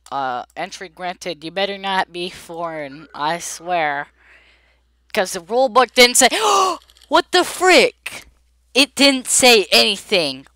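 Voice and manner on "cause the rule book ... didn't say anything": high, loud outburst of disbelief; indignant shout. Real, not acted. Player blames rule book for the penalty, indignant shout. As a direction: yell in outrage, jumping up in pitch.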